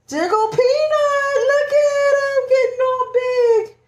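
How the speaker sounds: slow high mommy coo. Real, not acted. Cooing at ultrasound-sized bump.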